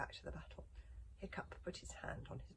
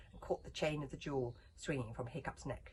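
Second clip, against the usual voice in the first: ordinary delivery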